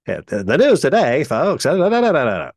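punchy mid-range radio host; old-time radio voice. Retold or imitated. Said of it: "that's why old-timey radio voices sounded like that".

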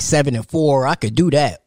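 ordinary delivery

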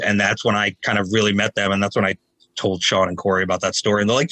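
normal talking voice

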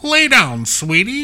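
high, loud smarmy 1920s doctor. Retold or imitated. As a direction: coo it like a sleazy old-time doctor. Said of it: "That's my doctor voice from the nineteen twenties".

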